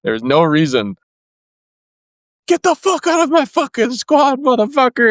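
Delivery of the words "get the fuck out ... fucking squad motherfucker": high-pitched furious barking of orders; drill instructor yell. Retold or imitated. Sergeant screaming at a recruit during group PT, furious high yell. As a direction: scream him out of the squad.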